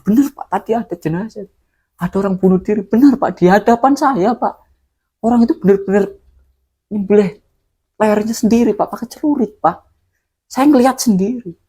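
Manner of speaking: high, pleading, shaken insistence; desperate insisting. Retold or imitated. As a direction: beg them to believe you. Witness insists to doubting villagers. (meyakinkan warga dengan suara tinggi dan gemetar)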